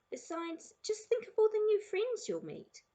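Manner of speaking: high, upbeat coaxing; cheery encouragement. A narrator's reading. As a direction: talk it up to a reluctant child. Father cheering Sarah on.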